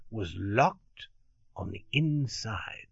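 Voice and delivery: hushed whisper on the reveal; storyteller's secret whisper; dramatic whisper. A narrator's reading. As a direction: drop to a whisper for the twist. Locked-room reveal read aloud.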